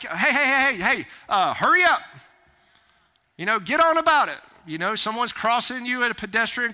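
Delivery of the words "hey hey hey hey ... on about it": high, loud hurry-up call; loud, pushy "get moving" voice; brusque urging. Acted. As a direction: chivvy them along impatiently. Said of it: "we can talk like this".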